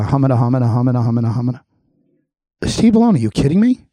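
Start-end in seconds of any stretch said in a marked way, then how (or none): 0.0-1.6 low, monotone mumbo-jumbo babble; mock flustered stammering. Host mocking the official's answer about the police chief, flustered babble. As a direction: imitate someone stuck for an answer, on one low note. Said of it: "you basically said very gracefully".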